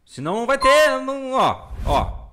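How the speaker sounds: high, loud urgent plea; excited pleading. Real, not acted. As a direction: beg them, voice up high and loud. Begging viewers to subscribe.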